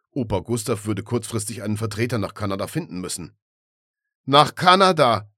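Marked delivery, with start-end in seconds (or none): 4.2-5.3 loud, slow outcry; exclaiming. Acted. Kunibert in the cellar, crying out loud and slow. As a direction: call it out in disbelief. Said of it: "rief Kunibert aus".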